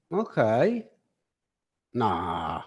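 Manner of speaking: wow, mm, aha, okay, nah in varied tones; tone of voice demo. Shown as a demonstration. Teacher showing his class tone of voice, varied interjections. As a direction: show how tone alone carries meaning. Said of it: "This is the tone of voice".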